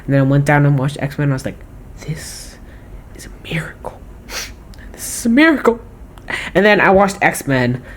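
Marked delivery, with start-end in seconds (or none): 2.0-4.0 hushed, whispered wonder; awed whisper. Retold or imitated. Awe at discovering X-Men. 4.9-5.8 amazed. Retold or imitated. Awe at discovering X-Men.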